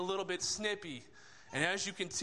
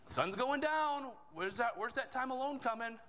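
higher-pitched, put-out mock griping; snippy complaint; 7 semitones higher than usual. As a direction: imitate disciples getting snippy with the Lord. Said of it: "getting a little bit snippy with the Lord".

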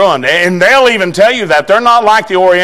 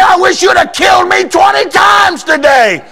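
desperate shout; 11 semitones higher than usual. Retold or imitated. Boy shouting back to his dad in despair. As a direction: cry it out like a tortured boy. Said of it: "I said".

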